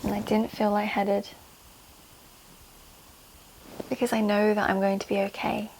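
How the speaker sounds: measured, pause-filled meditation delivery; calming guided affirmations. Shown as a demonstration. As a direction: guide them gently into calm, phrase by phrase. Leads calming tapping affirmations.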